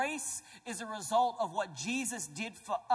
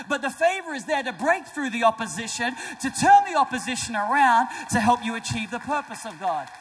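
loud, driving sermon climax; preaching at full force; 8 dB louder than usual. Acted. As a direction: preach it out hard to the crowd.

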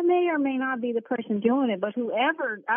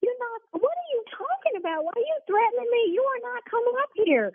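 much higher, indignant outburst; outraged; 12 semitones higher than usual. Real, not acted. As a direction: fire back at him, high and shocked. Shop owner threatened over phone.

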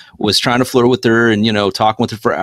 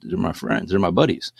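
deadpan reply; 4 semitones lower than usual. Retold or imitated. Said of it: "I'm like".

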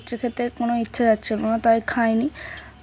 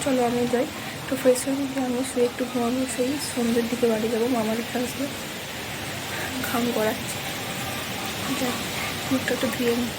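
speaking softly; 6 dB quieter than usual. Real, not acted. Vlogger whispering distance from sleeping relatives, speaking softly. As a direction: keep it down so the sleepers aren't disturbed. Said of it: "একটু আস্তে আস্তে কথা বলছি".

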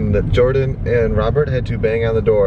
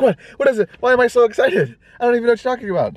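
high-pitched imitation of an excited freak-out; mock freaking out; 13 semitones higher, faster than usual. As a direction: squeal it in giddy, baffled excitement, mimicking her. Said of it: "she literally starts freaking out".

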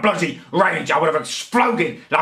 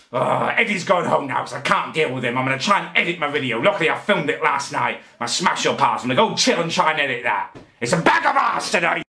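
normal talking voice

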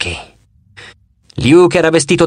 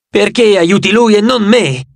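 a wounded, drawn-out yell; shouted; 5 semitones higher than usual. A narrator's reading. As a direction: hurl the accusation at her. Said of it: "gridò Nico".

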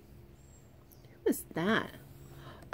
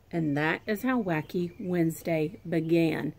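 put-on lively read-aloud for kids; story reading; 3 dB louder than usual. A narrator's reading. Teacher reading a picture book to her pre-K class, lively. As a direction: read it like circle time for little ones.

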